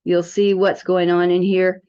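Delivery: usual speaking voice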